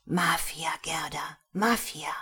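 whispered revelation to a friend; low-voiced, insistent warning; hushed, urgent whisper. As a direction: whisper it so nobody nearby hears. Whispers alarming secret to friend.

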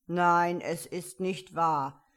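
loud, firm caterpillar voice; emphatic creature refusal; contradicting caterpillar. A narrator's reading.

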